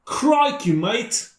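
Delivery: high-pitched mock Australian accent. Co-host taking up the challenge to go Australian, mock Australian accent. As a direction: burst out with a hammed-up Australian greeting. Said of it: "you could go Australian".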